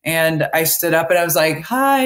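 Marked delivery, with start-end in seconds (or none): 1.6-2.0 eager greeting pitched well up; a quick, high, eager hello. Retold or imitated. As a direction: pop up keen to meet the room. New hire introduced at meeting.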